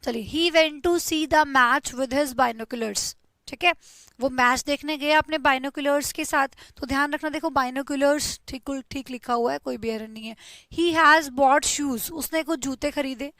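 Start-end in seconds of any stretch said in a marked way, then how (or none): 0.3-3.2 very slow example reading; slow dictation. Shown as a demonstration. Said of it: "reading example sentence slowly". 10.7-12.1 very slow, louder dictation. Shown as a demonstration. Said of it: "dictating example sentence".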